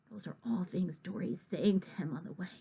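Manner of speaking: soft unvoiced whisper, quick; whispered aside. Real, not acted. Narrator confiding to listener, quick whisper. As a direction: murmur it fast under breath.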